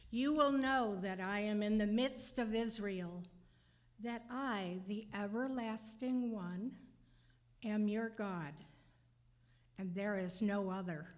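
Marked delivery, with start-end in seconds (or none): none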